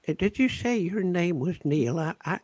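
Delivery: put-on high, hesitant little old lady; frail old lady voice. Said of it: "makes him sound like a frail old lady".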